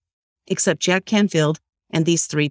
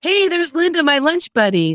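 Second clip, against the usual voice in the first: yelled greeting; 11 semitones higher than usual. Retold or imitated. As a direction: call out her name from a distance. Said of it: "he yells out".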